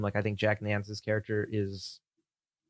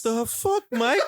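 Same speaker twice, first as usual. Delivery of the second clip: high, loud, laughing; mock jock; 13 semitones higher, 5 dB louder, much slower than usual. Host mimics the jock friend, high and laughing. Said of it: "his friend's like".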